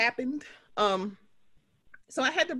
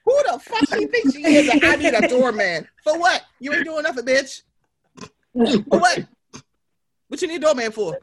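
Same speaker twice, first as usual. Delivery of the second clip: loud, high-pitched mock tirade; blackout mad; 4 semitones higher, 7 dB louder than usual. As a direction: rant furiously, punching out the indignant questions. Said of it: "blackout mad".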